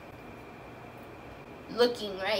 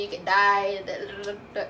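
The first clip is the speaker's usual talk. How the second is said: normal talking voice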